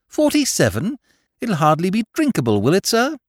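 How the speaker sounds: high, loud incredulity; astonished gentleman, voice leaping up. A narrator's reading. Shocked at the old port's age.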